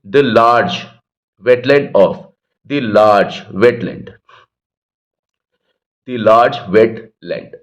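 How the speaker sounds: very slow, word by word; teacher spelling out each word slowly; slow dictation. Shown as a demonstration. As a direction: read it out slowly like dictation to a class. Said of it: "कुछ लोग इसको वेट और land को अलग-अलग बोलते हैं".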